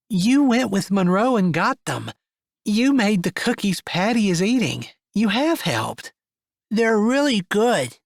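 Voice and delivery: put-on high, slowing little girl. Little girl Patty voiced.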